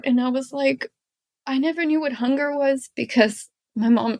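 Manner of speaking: really tearful, high-pitched voice; crying. Real, not acted. Guest recalls seeing hungry kids when little, crying. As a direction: talk through tears, voice high, halting between phrases. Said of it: "I'm gonna start crying now".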